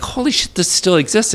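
a replayed jolt of surprise; imitating his own amazement at the shelf; retold disbelief. Recalling shock at porn rack.

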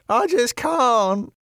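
high strained cry with eyes shut; anguished cry. Acted. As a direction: wail it out in mock anguish, pitched far up. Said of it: "Wayne cried".

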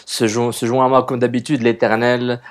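loud, bright podcast opening; show host welcome. Acted. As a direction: announce the show with energy. Host's show opening announcement. (animateur qui ouvre l'émission avec entrain)

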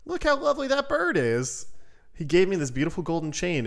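high, lightly excited father; delighted father. Acted. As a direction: show off his gift proudly. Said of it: "went inside and said".

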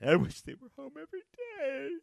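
high-pitched, hammed-up tearful whine; mock crying. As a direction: squeal it out as if bawling. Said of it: "I don't cry like that either".

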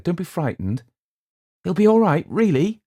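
high, loud anxious reassurance; reassuring. A narrator's reading. Theodora reassuring.

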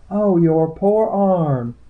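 high, slow cry of pity; high-voiced, drawn-out sympathy; pitying healer. A narrator's reading. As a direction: coo over it in a high, drawn-out voice. Healer pities his crippled arm.

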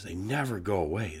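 ordinary talk

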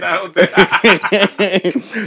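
slow, loud, laughing through the words; laughing quote. Retold or imitated. Laughing at his friend while quoting himself, slow and loud. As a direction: laugh out the denial slowly. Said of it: "I said".

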